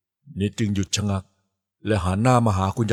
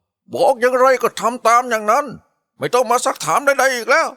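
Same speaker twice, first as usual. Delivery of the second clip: much higher and louder sudden snap; grandma turning cruel, shouting the girl out of the room; furious scolding; 4 dB louder than usual. Acted. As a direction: bark the order at her, menacing. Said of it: "เสียงตวาดขึ้นมาว่า".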